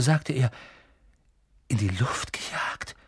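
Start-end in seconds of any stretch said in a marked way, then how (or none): 1.7-3.0 erschüttert. A narrator's reading. Harry erschüttert, bleich.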